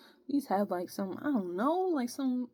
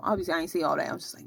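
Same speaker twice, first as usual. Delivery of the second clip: usual speaking voice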